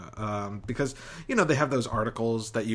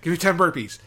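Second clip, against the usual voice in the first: barked order, louder and higher; drill sergeant; 6 semitones higher, 6 dB louder than usual. Pretend. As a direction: bark it like a gym trainer. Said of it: "You sound like my trainer".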